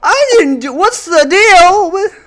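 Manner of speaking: high, slow standup comic voice; Seinfeld impression. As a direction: do a Seinfeld bit. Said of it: "That was like Seinfeld there".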